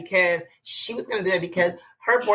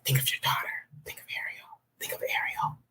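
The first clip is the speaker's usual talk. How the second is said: pleading whisper, unvoiced and hushed; imitating a pushy customer in a hushed whisper; 9 dB quieter than usual. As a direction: whisper it like a guilt trip. Whispered retold plea.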